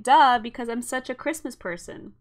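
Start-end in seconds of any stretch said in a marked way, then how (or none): 0.0-0.4 very loud sarcastic duh; mock duh. Retelling her own reaction to the pattern, a loud mock duh. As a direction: fling out an obvious duh. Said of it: "which I'm like".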